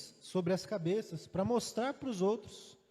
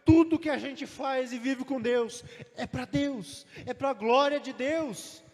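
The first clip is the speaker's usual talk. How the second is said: full-force preaching; 4 semitones higher, 4 dB louder than usual. Acted.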